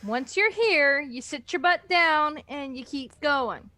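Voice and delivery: ordinary talk, nothing marked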